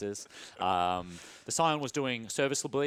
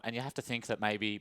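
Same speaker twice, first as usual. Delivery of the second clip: normal talking voice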